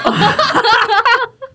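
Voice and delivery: loud, high laughter. Real, not acted. Guest laughing after her own punchline, high laugh.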